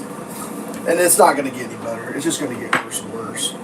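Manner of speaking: low, soft and hopeless; resigned gloom. Real, not acted. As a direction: give up quietly, voice sunk low. Resigned that things will worsen.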